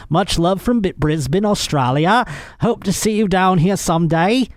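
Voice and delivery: bad Australian accent. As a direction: attempt Australian anyway, lifting the pitch. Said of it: "I did it anyway".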